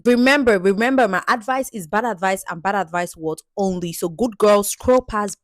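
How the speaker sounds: loud emphatic warning; loud, punchy disclaimer. Real, not acted. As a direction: call out the warning with force.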